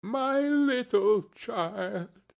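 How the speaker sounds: high, slow, voice breaking into tears; a grieving father breaking down. A narrator's reading. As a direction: let the father's grief break through. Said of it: "He broke down all at once".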